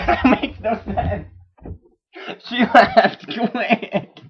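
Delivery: high, slow words broken by real laughter; laughing. Real, not acted. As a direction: crack up while getting the words out. Teller cracks up at own line.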